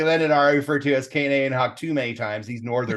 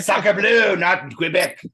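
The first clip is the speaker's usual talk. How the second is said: mock French; 3 semitones higher, much slower than usual. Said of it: "he loves my French accent".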